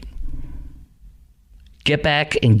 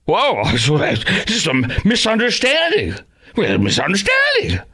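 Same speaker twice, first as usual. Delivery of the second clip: mocking Scheffler; 7 semitones higher than usual.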